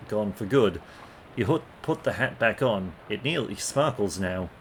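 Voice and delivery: usual speaking voice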